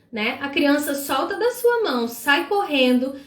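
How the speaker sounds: usual speaking voice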